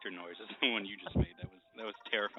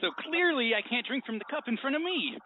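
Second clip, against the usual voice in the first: hammy movie villain; 6 dB louder than usual. Pretend. Hammy reasoning quote among friends.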